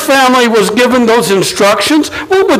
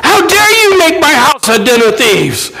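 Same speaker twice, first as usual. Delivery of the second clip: raised, higher righteous anger; indignant rebuke; 5 semitones higher than usual. Retold or imitated. Jesus scolding the money changers, raised indignant voice. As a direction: drive them out with outrage.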